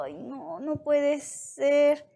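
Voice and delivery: slow, drawn-out dismay; acting out a king's drawn-out disbelief. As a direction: lament it slowly, in storytelling style. King dismayed at the accusation.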